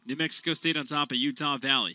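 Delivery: normal talking voice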